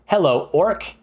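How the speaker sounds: hammed-up adventurer hailing an orc; friendly adventurer. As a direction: greet a monster in pretend play. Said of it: "you walk up to the orc and you go".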